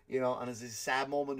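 ordinary talk

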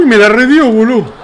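real gamer outburst with a laugh; excited yelp. Real, not acted. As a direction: blurt it out, laughing at the hit. Getting hit hard in a fight game.

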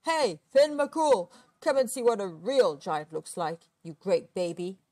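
loud giant calling across the sea; bellowing. A narrator's reading. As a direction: bellow it out, stretching the first call. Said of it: "he bellowed".

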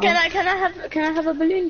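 repeated high little plea; high-pitched pleading. Pretend. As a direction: beg for it in a high voice. Begging the friend for a balloon.

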